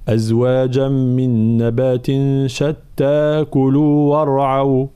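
slow melodic recitation, linking the verses; Quranic recitation. Shown as a demonstration. Teacher reciting to students how the verses join. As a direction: recite it in one connected, measured flow. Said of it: "فحال الوصل".

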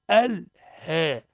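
الهاء drawn out with its "a" bent toward "e"; slow "al-hee", the long vowel fronted in الهاء; vowel tilted toward kasra. Shown as a demonstration. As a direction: stretch الهاء, leaning from a toward i. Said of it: "ما لها كنزلت من الفتحة نحو الكسر".

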